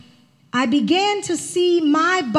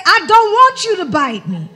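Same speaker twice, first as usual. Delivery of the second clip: higher, louder insistent plea; what I try to say; 5 semitones higher, 7 dB louder than usual. Shown as a demonstration. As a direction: push "I don't want you to bite me" up and loud, pleading. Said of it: "But I'm trying to say".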